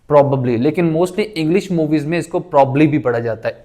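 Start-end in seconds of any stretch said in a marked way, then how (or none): 0.1-0.6 "probably" as PROB-ub-lee; three syllables, weak middle in "probably"; correct pronunciation. Shown as a demonstration. Teacher models it for students, careful "probably" as probubly. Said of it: "इस ए को ख़त्म कर दें". 2.4-2.9 "probably" squeezed to PROB-lee; casual pronunciation. Shown as a demonstration. Teacher cites English movies, casual "probably" as probly. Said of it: "एक ही बी को पढ़ा जाता है".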